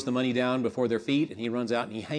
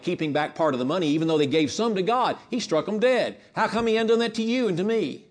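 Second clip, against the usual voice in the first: preaching at full force; 5 semitones higher, 4 dB louder than usual. Acted. Preacher pressing the congregation on God's patience, building urgency. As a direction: drive the question home, building to a peak.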